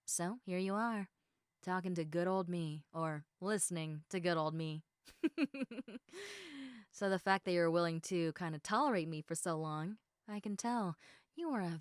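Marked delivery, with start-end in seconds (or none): 5.2-6.7 a light breathy chuckle at herself; soft laugh. Real, not acted. Chatting with her new friend, a soft self-amused laugh. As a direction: laugh softly at your own joke.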